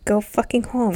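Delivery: ordinary delivery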